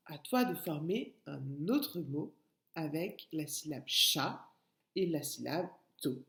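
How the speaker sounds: ordinary delivery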